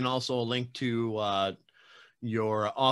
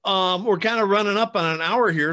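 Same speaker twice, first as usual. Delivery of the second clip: upbeat wrap-up; 8 semitones higher, 6 dB louder than usual. Real, not acted. Host wraps up the interview.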